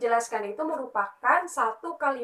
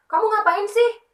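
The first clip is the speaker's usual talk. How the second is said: much higher and louder, an angry snap; angry example; 10 semitones higher, 7 dB louder than usual. Shown as a demonstration. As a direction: demo an angry scold for the class. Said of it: "kalau teman-teman lagi marah, pasti nadanya tinggi".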